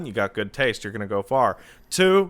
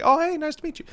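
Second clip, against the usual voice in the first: friendly greeting; 13 semitones higher, much faster than usual. Shown as a demonstration. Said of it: "Learn how to shake somebody's hand like".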